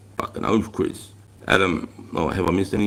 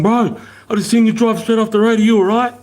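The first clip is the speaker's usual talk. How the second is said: high, loud mock trucker voice; truck driver; 11 semitones higher, 4 dB louder than usual. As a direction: imitate the worried truck driver calling down. Retelling the truck driver's words.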